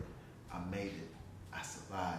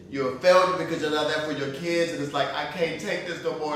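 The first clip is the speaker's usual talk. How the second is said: loud, raised voice pouring out despair; anguished outburst; 6 semitones higher, 16 dB louder than usual. Real, not acted. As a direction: cry it out at full force, high and loud. Anguished testimony of breaking point.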